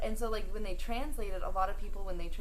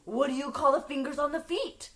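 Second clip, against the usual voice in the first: raised, louder mock-exasperated question; imitating upset husband; 5 semitones higher, 6 dB louder than usual. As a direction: demand it like the fed-up husband. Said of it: "he's, like, really upset with her".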